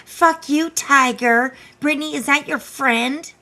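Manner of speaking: high, loud mock impression; mocking imitation. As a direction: parrot the caller mockingly. Host mimics clip line.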